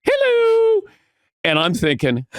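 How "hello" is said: high falsetto hello mocking the boss. [name] mimicking Bob's greeting on air, high falsetto. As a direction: squeak it way up high like Bob. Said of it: "And [name] goes".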